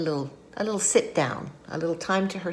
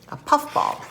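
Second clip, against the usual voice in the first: usual speaking voice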